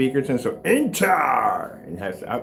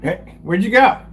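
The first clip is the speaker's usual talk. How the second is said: loud; 6 semitones higher, 7 dB louder, slower than usual. Real, not acted. Talking loudly to cat.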